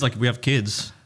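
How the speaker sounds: normal talking voice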